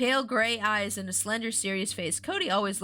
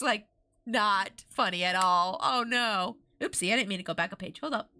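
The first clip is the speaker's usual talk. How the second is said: usual speaking voice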